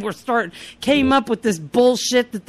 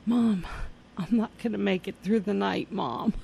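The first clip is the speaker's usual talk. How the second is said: soft, lowered mock deathbed plea; drama queen's dying words; 4 semitones lower, 8 dB quieter than usual. Daughter sure she won't last the night, feeble plea to mother. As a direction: murmur it as if fading away. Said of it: "I was a drama queen".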